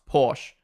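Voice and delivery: Porsche said as PORSH; no uh after the sh in Porsche; one-syllable Porsche. Shown as a demonstration. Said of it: "that's how that word's pronounced".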